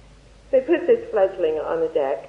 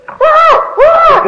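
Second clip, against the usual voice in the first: loud, high imitated crow cry; hammed-up crow caw, much higher and louder; crow squawk; 18 dB louder than usual. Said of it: "Crow goes".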